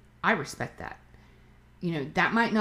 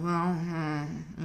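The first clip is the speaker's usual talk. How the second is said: slow, mildly mopey mumbling impression; 4 dB quieter than usual. Imitating a mopey person who talks kinda low, mildly mopey. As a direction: imitate someone moping, dragged out a little. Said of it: "they just kinda talk kinda low".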